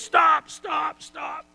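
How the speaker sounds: high, loud commanding cry; shouted stop. Retold or imitated. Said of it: "the big chief goes".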